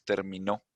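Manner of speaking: "terminó" with the final "nó" stressed; teacher's demo of "terminó", stress landing on "nó"; stress on final syllable. Shown as a demonstration. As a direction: lift the last syllable of "terminó". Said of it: "la sílaba que se pronuncia más fuerte va al final".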